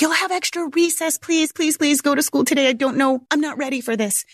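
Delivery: high, begging please please please; mock desperate begging; pleading. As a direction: bargain with the kid, half panicked. Mom begs son to attend school.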